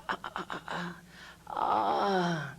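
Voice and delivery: breathy ah, ah, ah of a yawn; yawning release sound. Shown as a demonstration. Teacher demonstrating a yawn's release sound. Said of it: "sound release".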